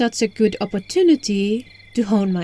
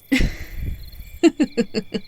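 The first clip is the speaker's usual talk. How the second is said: long breathy chuckle; amused laugh; 3 dB quieter than usual. Acted. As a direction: laugh it off lightly. Amused by the listener's denial.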